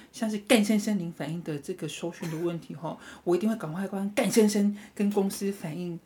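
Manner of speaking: even, courteous patter that leans on 干; stress on 干. Shown as a demonstration. Agent promises to report signal problem, pointedly stressing 干. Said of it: "因为我那个干实在是拉太大声了".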